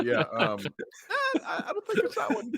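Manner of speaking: high, breaking into laughter; talking through laughs; laughing. Real, not acted. Laughing at a crude joke.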